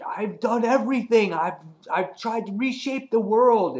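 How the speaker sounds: high-pitched, worked-up protest; Cyclops freaking out. Retold or imitated. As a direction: act out a character losing it. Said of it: "He's, like, freaking out".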